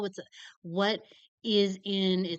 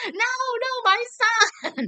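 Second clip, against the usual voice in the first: mock wail; 9 dB louder than usual. Mother's outburst on the phone.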